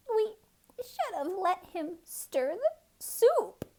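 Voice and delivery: sniffle it out in a high little voice; sniffling squirrel. A narrator's reading. Squirrel regretting the soup to the cat, sniffling. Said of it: "sniffled the squirrel".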